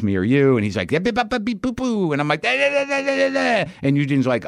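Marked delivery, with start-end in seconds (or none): 0.9-2.1 high, silly beeping babble; mock beeping. Mocking a friend's argument as high beeping. 2.4-3.7 very high, strange da-da babble; mock nonsense babble. Mocking himself arguing in the car, very high nonsense. Own reply mocked as nonsense.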